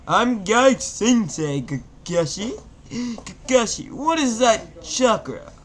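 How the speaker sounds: high, loud, slow alarmed call; hammed up excitable sensei calling out; Guy Sensei voice. A narrator's reading. Guy alarmed, calling to Kakashi about chakra.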